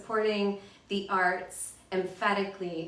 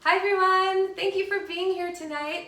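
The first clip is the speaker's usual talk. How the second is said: high, loud opening hello to a crowd; bright public greeting; 11 semitones higher, 8 dB louder than usual. Real, not acted. Host welcoming a poetry audience for tonight's reading, bright greeting. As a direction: greet the whole room, pitched well up.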